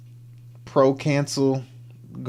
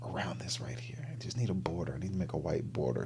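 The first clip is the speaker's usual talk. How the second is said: whisper; 5 semitones lower, 14 dB quieter than usual. Real, not acted. Muttering to himself while editing.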